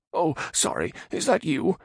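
high nervous man voice; frightened Radagon. Acted. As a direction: ask in timid fear. Radagon timid.

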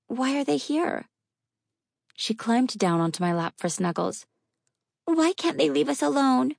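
put-on high, plaintive little girl; worried child voice. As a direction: ask like a scared young girl.

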